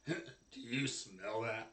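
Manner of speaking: a hint of a slow, low guard; guard character. Guard sniffing the air at a caged prisoner, lightly voiced guard.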